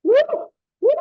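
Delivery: two loud whooping cheers; excited whoops. Real, not acted. Cheering a Lennox Lewis versus Foreman matchup, loud whoops. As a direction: whoop it out like a fan at ringside.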